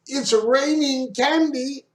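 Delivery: high, drawn-out joking exclamation; mock amazement. Teacher joking for the class, high mock amazement. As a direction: stretch it out slowly, way up high. Teacher hamming up a pun joke.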